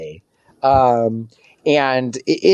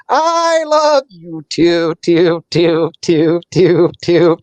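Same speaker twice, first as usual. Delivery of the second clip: glitchy robot possum; 3 semitones higher, much slower than usual. Acted. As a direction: stammer like a jammed clockwork toy. Possum hugs Daxon, stuck looping.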